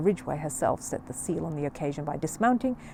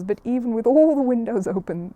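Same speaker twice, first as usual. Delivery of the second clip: ordinary delivery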